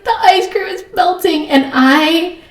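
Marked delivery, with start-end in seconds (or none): 0.0-1.5 high-pitched imitation of a wailing child; crying child. Daughter repeats her complaint to mom, mock crying. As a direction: whine it like an upset little girl. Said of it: "And she's like".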